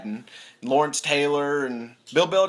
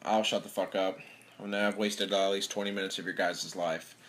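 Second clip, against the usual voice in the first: ordinary delivery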